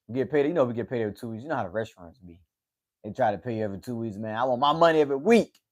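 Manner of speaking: usual speaking voice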